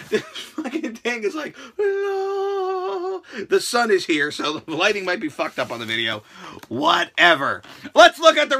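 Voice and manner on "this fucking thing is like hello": high squeaky laughing hello. Acted. Mocking the blinding bulb, high and laughing. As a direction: giggle out the bright bulb's hello.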